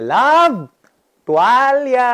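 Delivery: wife shouting for the towel from the shower, high call. Retold or imitated. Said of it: "sumigaw siya sa akin".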